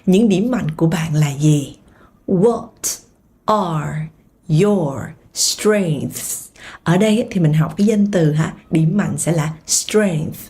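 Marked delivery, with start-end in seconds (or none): 2.3-6.5 slow, each word apart. Shown as a demonstration. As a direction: drill it slowly for learners. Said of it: "Âm đuôi là âm th".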